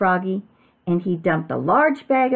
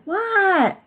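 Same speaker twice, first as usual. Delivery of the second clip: very high, drawn-out answer; calling back; 14 semitones higher than usual. A narrator's reading. Froggy answers his father's call, high-pitched shout back. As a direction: squeal it back from another room.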